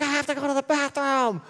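put-on high, childlike whine; little girl voice. Toddler daughter tells dad she must go, small high voice. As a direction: play the toddler who needs the bathroom.

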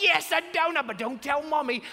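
high, loud silly dad voice; hammed-up giddy secret-sharing; weird secret voice. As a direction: play along in a squeaky co-conspirator voice. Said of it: "Our voices change. We act all weird".

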